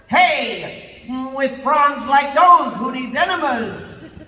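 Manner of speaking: loud, high, slow pun delivery; hammed-up punchline. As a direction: sell the pun big and slow.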